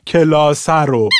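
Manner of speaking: کلاس رو said slowly in standard Tehrani form; کلاس رو said slowly, standard pronunciation; standard Persian. Shown as a demonstration. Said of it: "این کلاس هر مثلاً دوست دارم".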